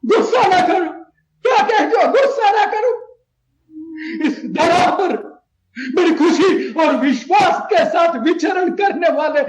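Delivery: worked-up, incredulous protest; angry outburst. Acted. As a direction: throw the plea for calm back angrily. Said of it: "आप गुस्सा मत कीजिए".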